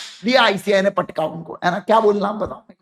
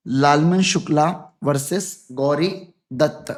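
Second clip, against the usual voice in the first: slow, syllable by syllable; dictating a case name; 5 semitones lower, 3 dB quieter than usual. Shown as a demonstration. Teacher dictating case name to students, slow and clear. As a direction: give the case name slowly so all can write it.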